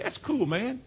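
high, soft slang with a rising end; mock a hip talker, lifting the pitch; imitating cool talk. Preacher mimicking what the world likes him to say, imitated cool talk. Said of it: "this is what they like for me to say".

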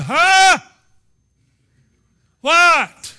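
high, loud startled wake-up cry; boy Samuel waking to a voice, startled shout. Retold or imitated. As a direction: jolt awake and call out. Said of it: "pulled his covers back and said".

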